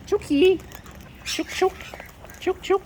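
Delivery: high sing-song chook chook call; calling chickens. Real, not acted.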